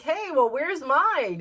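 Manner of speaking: high, slow, loud mock complaint; hammed-up "what about me" protest; mock indignant demand. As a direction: demand your share, playfully put out. Acts out own envious reaction.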